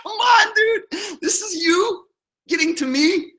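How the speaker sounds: high-pitched, talking through laughter; laughing. Real, not acted. Laughing off Eric's attempt to hurt him, cracking up. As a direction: squeal the words out while cracking up.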